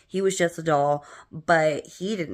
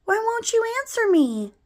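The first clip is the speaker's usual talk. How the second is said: put-on very high, pleading little boy; put-on tiny child voice, high-pitched; little brother's voice; 4 dB louder than usual. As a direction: plead like a frustrated small child. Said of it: "I hear my brother say".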